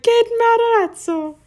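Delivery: high, slow, hammed-up Italian accent, laughing; mock Italian accent. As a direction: parody a friend's squeaky Italian accent. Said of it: "your Italian accent is so high-pitched".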